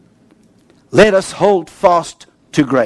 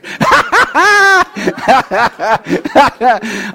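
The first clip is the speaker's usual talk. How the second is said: long hearty real laugh, higher; 6 semitones higher than usual. Real, not acted. Laughing at his own story.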